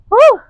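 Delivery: high loud whoop of relief; excited whoop. Real, not acted. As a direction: let out a big cheer after the last rep. Cheer after finishing set.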